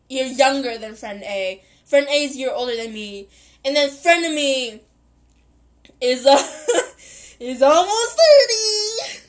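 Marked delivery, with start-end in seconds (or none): 6.0-9.3 giggling through the words; can't stop laughing; higher, slower, laughing out loud. Real, not acted. Breaks up laughing.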